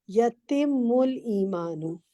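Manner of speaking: "यतिमुल ईमानु" slow and careful; correction reading. Shown as a demonstration. Teacher models the corrected phrase for the student. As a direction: spell out "यतिमुल" slowly. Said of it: "अगर आप correction कर लें".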